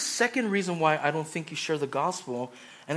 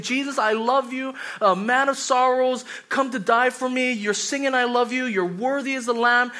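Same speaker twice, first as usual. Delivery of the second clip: fervent worshipper; 7 semitones higher, 7 dB louder than usual. Retold or imitated. Mocking Sunday worshipper's praise.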